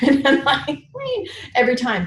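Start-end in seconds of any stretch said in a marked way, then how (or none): 0.1-0.7 laughing. Real, not acted. Laughing while telling story. 0.9-1.3 gushing. Retold or imitated. Gushing over Wayne Dyer.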